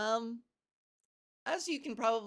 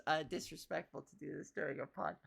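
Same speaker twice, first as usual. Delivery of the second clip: quiet aside; 5 semitones lower, 5 dB quieter than usual. Real, not acted. Self-aware aside.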